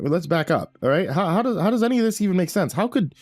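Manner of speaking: high, stammering rapid objections; Paul questioning his future self; flustered young Paul. A narrator's reading. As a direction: tumble over the words in disbelief.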